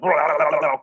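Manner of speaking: babbling cartoon-whirlwind growl; Tasmanian devil noise. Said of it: "who's like Tasmanian devil".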